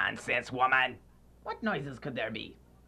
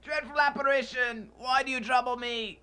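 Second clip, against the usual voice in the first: much higher, louder panicked plea; terrified cry; 12 semitones higher, 5 dB louder than usual. Acted. Scrooge crying out in terror at Marley's ghost.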